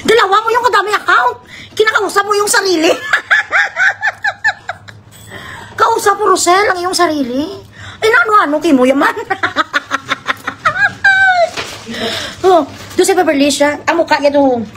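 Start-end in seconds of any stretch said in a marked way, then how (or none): 3.0-4.7 very high laugh; real amused laughter; high cackle. Real, not acted. Laughing at viewer reactions. 9.2-12.4 long high falling laugh; real helpless laughter; long cackle. Real, not acted. Laughing at viewer reactions.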